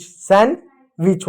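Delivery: सैंडविच slowly as san, wich; silent D. Shown as a demonstration. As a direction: leave the d out of सैंडविच. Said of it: "सैंडविच नहीं". (डी silent रखकर "सैंडविच")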